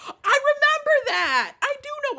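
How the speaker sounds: high-pitched cry of recognition; excited outburst. Real, not acted. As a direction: burst out high as it comes back to you. Reacts to a recalled film scene.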